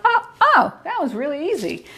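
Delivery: a high, startled oh, then an easy laugh-free finish; mock surprised relief. Teacher imitating her students after they flip the lattice, high and mock-surprised. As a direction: sound amazed it was so simple. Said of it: "and they're like".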